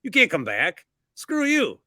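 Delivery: mock-indignant fan telling off a rival; offended Ravens fan. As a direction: play an affronted fan. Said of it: "if you're a Ravens fan, you're offended".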